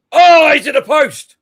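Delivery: rising, loud live call of a shot on goal; excited shout. Real, not acted. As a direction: call the near miss as it happens, slowed.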